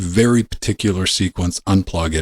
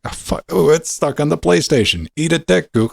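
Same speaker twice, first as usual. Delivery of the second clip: mock taunting Google; 10 semitones higher than usual. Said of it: "It's like".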